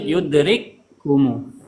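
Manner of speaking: "Yudrikkumu" slow, doubled kaf held; merged kaf. Shown as a demonstration. Teacher showing the kaf merged into one, slow. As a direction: hold the kaf in "Yudrikkumu" like a shadda. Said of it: "seolah ada tasydid".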